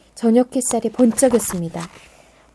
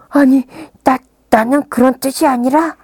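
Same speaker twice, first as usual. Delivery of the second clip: flustered and louder; 5 semitones higher, 7 dB louder than usual. Acted. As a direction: stumble over the denial. Flustered denial. (당황해서)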